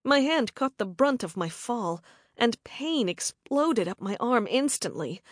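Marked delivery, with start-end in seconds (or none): none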